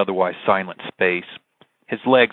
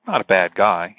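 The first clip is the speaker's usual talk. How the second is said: ordinary delivery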